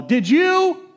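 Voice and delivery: hammed-up mother yelling at kids; mock mom yell. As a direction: shout it like a mom at the door. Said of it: "mom would yell".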